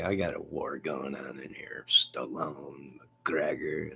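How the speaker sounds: hushed whisper, barely voiced. Real, not acted. Whispering the studio war gossip to the co-hosts. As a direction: lean in and whisper the secret. Secret aside about the war inside.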